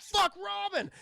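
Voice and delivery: high, loud drawn-out shout; hammed-up cry of alarm; mock alarmed yell. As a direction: yell it down to a partner in trouble. Batman spots Robin in trouble.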